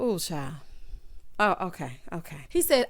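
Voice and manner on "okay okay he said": normal talking voice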